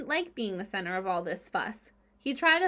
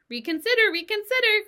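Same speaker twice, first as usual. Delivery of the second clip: much higher, loud honking plea; goose crying out; 12 semitones higher, 9 dB louder than usual. A narrator's reading. Goose pleads with Wilbur at the fence, loud honk. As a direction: wail the plea like a desperate goose. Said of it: "cried the goose".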